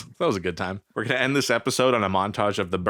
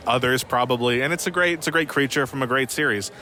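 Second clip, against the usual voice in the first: documentary narration; 3 semitones higher than usual. Acted. Voiceover for a played clip.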